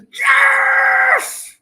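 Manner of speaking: sudden long, high, loud yell; real uncontrollable jubilation; ecstatic scream. Real, not acted. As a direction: burst into a held victory roar. Breaks off mid-sentence to celebrate.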